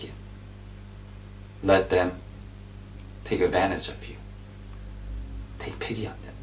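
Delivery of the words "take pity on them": gentle whisper; soft whispered plea. Real, not acted. Whispered exhortation.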